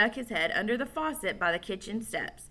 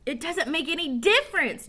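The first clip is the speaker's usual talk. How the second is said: yelled; 7 semitones higher than usual. Acted. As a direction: yell at him. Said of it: "Joe yelled at Billy".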